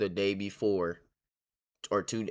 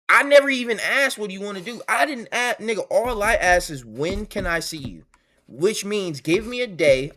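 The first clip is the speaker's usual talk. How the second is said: much higher and louder, indignant venting; heated rant; 9 semitones higher, 6 dB louder than usual. Real, not acted. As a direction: let the frustration burst out, pitched way up. Venting anger at ex mid-story.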